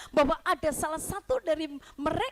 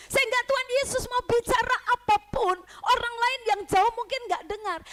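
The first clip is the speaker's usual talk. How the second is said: higher, louder preaching; emphatic preaching; 8 semitones higher, 7 dB louder than usual. Real, not acted. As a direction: build it up, press the point. Preaching loudly at full force. (khotbah bersemangat)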